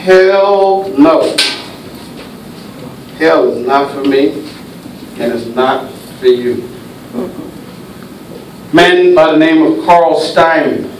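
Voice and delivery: usual speaking voice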